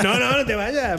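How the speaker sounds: playfully beg, pitched high; high, laughing mock plea; mock pleading. Mock plea as colleague threatens leaving.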